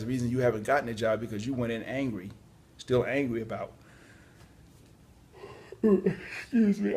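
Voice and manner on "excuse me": yawning. Real, not acted. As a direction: excuse me stretched out through a real yawn, high and slow. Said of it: "for, for the yawning".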